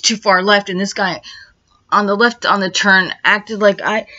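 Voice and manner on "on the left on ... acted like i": normal talking voice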